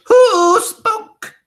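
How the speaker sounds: very high, loud furious shriek; Grand High Witch shrieking in rage. Acted. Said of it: "she shrieked".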